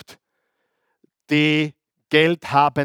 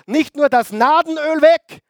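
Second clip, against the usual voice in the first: high, loud, rising outrage; fired-up preaching; 8 semitones higher, 4 dB louder than usual. Real, not acted. Preacher telling the church about Judas's lost donor, loud and high. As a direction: build the point up like a sermon climax.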